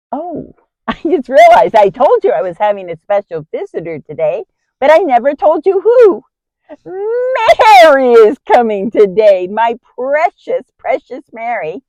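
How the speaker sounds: loud, high burst of joy; excited outpouring, stretching the words with delight; delighted announcement. Acted. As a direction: gush over a beloved visitor, loud and high. Delighted announcement of Mary's visit.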